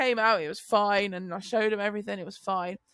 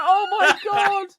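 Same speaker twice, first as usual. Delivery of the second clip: higher, slow, louder mortified groan; drawn-out embarrassed oh my God; 8 semitones higher, 7 dB louder, slower than usual. Retold or imitated. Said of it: "I'm just like".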